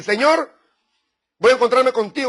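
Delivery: fervent prayer. Acted. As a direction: cry out to God with resolve. Preacher voices a prayer to God.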